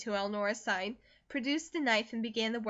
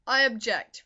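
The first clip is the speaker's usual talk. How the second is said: a firm, louder objection; emphatic, louder; 8 dB louder than usual. A narrator's reading. The man repeating his objection to Eleanor, louder and firm. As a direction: plant your feet and object firmly. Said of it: "repeated the man".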